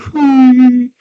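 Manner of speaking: high, loud fake wail; mock crying. Girl sitting down in the maze, mock crying wail. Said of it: "She's, like, crying".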